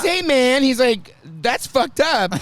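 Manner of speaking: imitating a scolding barber. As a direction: play the offended barber scolding. Barber Brian scolding, loud.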